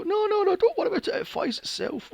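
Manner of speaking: very high, sing-song mock reassurance; mocking pilot voice. Mocking the pilot brushing off the nosedive. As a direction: squeak it out dismissively. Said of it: "And you go".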